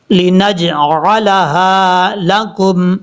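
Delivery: drawn-out Quranic recitation; slow melodic chant; slow tajweed recitation. Shown as a demonstration. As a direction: recite each letter clearly.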